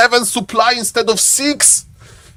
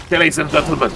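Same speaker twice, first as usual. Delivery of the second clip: much lower, softer sub-perk voice; deep put-on voice; 7 semitones lower, 6 dB quieter, much faster than usual. A deep put-on voice for a tier three sub. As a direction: drop into the special deep voice. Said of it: "That's why I'm talking like this".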